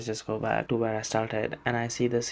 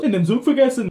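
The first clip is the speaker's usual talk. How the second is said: high, loud mock astonishment; imitating a startled clerk; surprised; 10 semitones higher, 7 dB louder, faster than usual. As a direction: blurt it out in hammed-up surprise. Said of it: "gets really surprised and goes".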